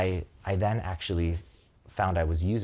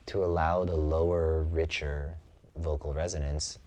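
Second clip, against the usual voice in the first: slow and lower, resonant from the chest; relaxed low voice; 4 semitones lower, slower than usual. Shown as a demonstration. Showing the relaxed voice he discovered, slow and lower. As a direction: settle down and let the voice drop. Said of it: "I'm gonna shift into it now".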